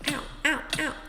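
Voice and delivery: pained ow, laughing stop it; yelping ow then giggling; laughing through pain. Real, not acted. Something hurting him mid-recording.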